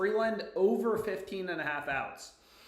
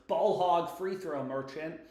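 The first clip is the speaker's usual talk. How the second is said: ordinary delivery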